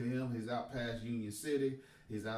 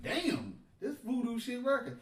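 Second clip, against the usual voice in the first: amazed realization; 11 semitones higher than usual. Retold or imitated. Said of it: "I'm like".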